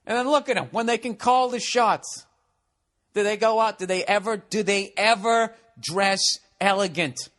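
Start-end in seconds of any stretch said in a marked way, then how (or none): none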